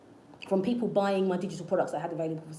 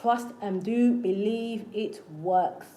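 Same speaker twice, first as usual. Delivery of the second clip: slow emphatic; much slower than usual. Real, not acted.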